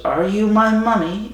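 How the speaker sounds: high, sing-out imitation of an eerie kid; creepy child. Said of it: "it goes".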